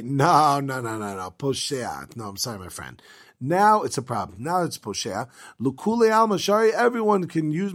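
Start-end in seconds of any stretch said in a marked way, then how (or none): 0.0-3.0 mock regretful refusal. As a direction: let him down with a mock sigh. Shmuel refuses the careless man.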